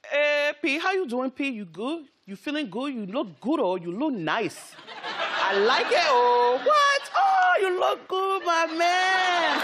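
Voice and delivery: loud, high Liberian accent. Shown as a demonstration. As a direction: greet a friend in a thick Liberian accent. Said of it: "What's a Liberian accent sound like?".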